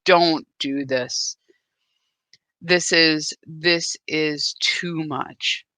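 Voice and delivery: slow, far-off inner warning voice; inner voice pleading. Retold or imitated. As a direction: let a frightened inner voice slowly beg you to stop. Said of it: "everything in me goes".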